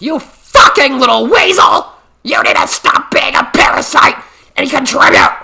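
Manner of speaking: high, loud screaming rant; imagined angry rant. Pretend. Imagined parent bursting in to scold him, loud furious rant. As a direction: tear into the freeloading son. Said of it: "been like".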